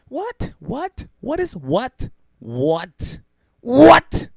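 high, rising baffled whats. Real, not acted. Baffled host reacting to Twitter beef, disbelieving. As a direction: repeat what in disbelief, last one loud.

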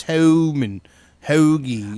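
mock Philly accent on the vowels. Host showing the guest that Philly thing, imitated Philly accent. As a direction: demonstrate the Philly way of saying it, vowels stretched. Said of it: "I just hear that Philly thing".

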